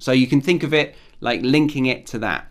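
ordinary talk

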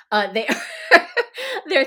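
words laughed out, a little slow; laughing. Real, not acted. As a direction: chuckle through the line. Laughing about the old donkeys.

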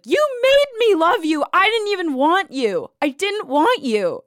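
very high accusing yell, easing off; retold screaming. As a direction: act out a bar meltdown at an ex. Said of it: "I was screaming at the top of my lungs in this bar".

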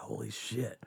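whispered shock, caught in the act; awed whisper of a kid snooping; stunned whisper. Retold or imitated. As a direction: breathe it out so no one hears. Friend finds vodka in drawer.

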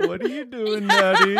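put-on high playful child voice; kid voice. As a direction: play a puzzled little kid. Kid voice joke.

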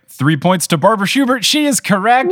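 rising, loud game-show reveal; big celebratory host voice; triumphant announcement. Real, not acted.

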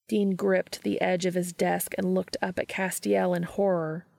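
usual speaking voice

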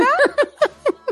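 high, delighted laugh bursting out; burst of laughter. Real, not acted. Mother laughing as she recalls the doorman's praise, high delighted laugh. Proud mother retelling the audition.

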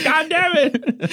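very high mock-exasperated curse; comic high-pitched grumble; imitating frustrated friend. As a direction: squeal it in mock annoyance.

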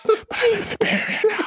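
teasing hushed impression; ASMR voice impression. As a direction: keep the mock whisper going. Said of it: "constant A S M R voice".

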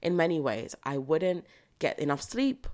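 normal talking voice

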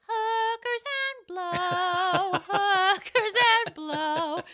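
put-on high, slow childish singsong. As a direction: imitate little kids chanting. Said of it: "them ... going".

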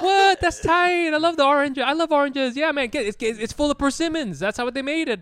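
high, loud, delighted voice; excited convert. Acted. Imitating a convinced Vietnamese listener, high and excited. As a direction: gush like a sold believer. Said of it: "And they'd be like".

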